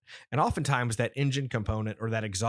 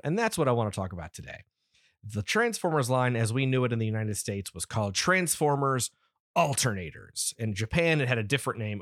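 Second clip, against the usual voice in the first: ordinary talk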